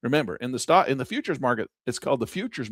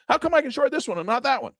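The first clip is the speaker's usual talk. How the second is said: much higher baffled complaint; baffled trader; 12 semitones higher than usual. Acted. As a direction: protest the unfairness, pitched way up. Acted trader-broker exchange.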